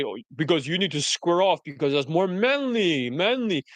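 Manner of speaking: put-on mocking voice, swooping up on "manly"; mocking rivals. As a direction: parody a pompous rival, stretching "manly". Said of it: "as they say".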